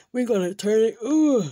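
talking through a yawn. Acted. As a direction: yawn mid-sentence, then excuse yourself. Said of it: "look at you yawning".

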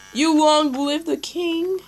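slow grand proclamation. Pretend. Hailing the lion as king, slow grand call.

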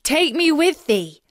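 high, loud desperate cry; frog screaming. Acted. Frog screams after the runaway princess, high and loud. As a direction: shout after her so she won't leave. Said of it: "as loudly as he could".

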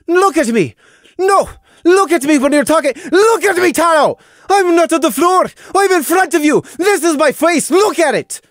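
high, loud, raging yells; shrieking demand to be looked at; furious shouting. A narrator's reading. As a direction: scream it into his face. Sister screams at brother.

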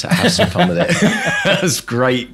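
laughing. Real, not acted. Host laughing.

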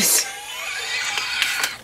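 breathy, wheezing laugh; laughing fit. Real, not acted. Reader cracking up at spirit's message, wheezing laugh. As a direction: laugh too hard to get the sentence out.

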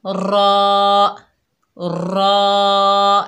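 रॉ slow with a trembling tongue; rolled, heavy रॉ; trilled raw. Shown as a demonstration. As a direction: let the tongue shake on रॉ. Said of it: "इस तरह कांपेगी".

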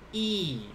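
"E" as a long, held "ee"; Japanese vowel I. Shown as a demonstration. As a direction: hold "E" out as a pure "ee". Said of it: "the I is basically E".

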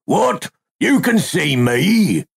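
high, startled cry of disbelief; exclaiming. A narrator's reading. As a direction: gasp it out, shocked to be seen. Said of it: "he exclaimed".

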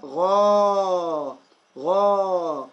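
غا with the alif held long and steady; slow teaching demo of a long غا; long vowel. Shown as a demonstration. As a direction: stretch the aa in غا on one level note. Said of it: "ألف يعني فتحة طويلة".

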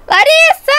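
high, loud name call; shouting. Acted. Girl calling her sister far out at sea, shouting. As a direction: call out hard across the distance.

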